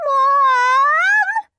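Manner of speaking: very high, loud wailing scream. A narrator's reading. Peter screaming for his mother, wailing. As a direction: wail for help. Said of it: "screamed Peter".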